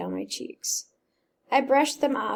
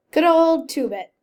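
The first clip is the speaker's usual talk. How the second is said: trembling, louder and higher; quavering voice; 6 semitones higher, 10 dB louder than usual. Acted. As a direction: let the voice wobble with homesickness. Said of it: "he said it in a quavering voice".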